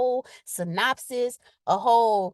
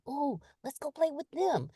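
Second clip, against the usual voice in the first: put-on inner kid; 3 semitones higher, 7 dB quieter than usual. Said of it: "my inner kid be like".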